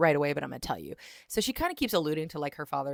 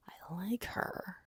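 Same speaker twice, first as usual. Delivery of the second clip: slow, breath-only whisper; hushed imitation of someone falling for her; whispered admission; 2 semitones higher, 10 dB quieter, much slower than usual. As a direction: whisper it slowly as his secret realization. Voices man's secret realization.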